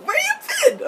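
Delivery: very high squeaky imitation; mock-giddy question way up high; giddy mimicry. Imitating giddy Alec asking where she's been, high squeal.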